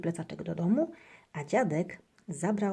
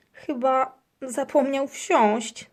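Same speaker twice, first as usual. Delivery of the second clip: high, loud, slow amused reply; girl giggling about the hamster; laughing; 7 semitones higher, 7 dB louder, much slower than usual. A narrator's reading. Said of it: "śmieje się Marysia".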